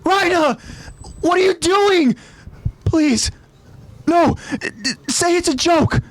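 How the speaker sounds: high, frantic, pleading voice; panicked cry. Acted. Marco pinned down, begging Raina in panic. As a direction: beg him to stop, stammering. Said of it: "Marco cries out".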